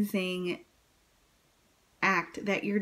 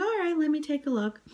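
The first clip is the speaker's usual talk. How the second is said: doctor voice; 8 semitones higher, faster than usual. Retold or imitated.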